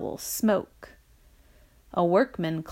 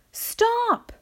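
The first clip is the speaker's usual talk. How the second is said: high, urgent yell; sharp high-pitched cry of alarm; 14 semitones higher than usual. A narrator's reading. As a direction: yell to halt someone. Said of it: "he yelled".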